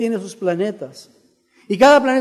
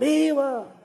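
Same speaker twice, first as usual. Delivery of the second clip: high, long-held cheer; crowd's answering cry; 7 semitones higher, 3 dB quieter than usual. Acted. All the townsfolk answering the grito, acted cheer. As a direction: echo it back like a whole crowd. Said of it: "Todos gritan".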